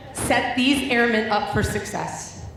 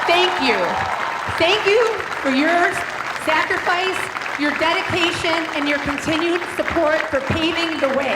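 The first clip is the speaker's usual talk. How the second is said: rousing thanks; 5 semitones higher than usual. Real, not acted. Cheering veterans standing.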